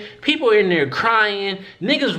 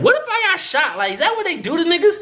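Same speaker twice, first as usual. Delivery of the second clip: much higher, disbelieving questions; incredulous; 11 semitones higher, faster than usual. Real, not acted. Imagining getting shot at this hospital, high incredulous voice. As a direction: voice shoots up in disbelief.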